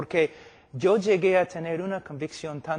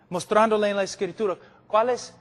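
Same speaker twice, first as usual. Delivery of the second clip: ordinary talk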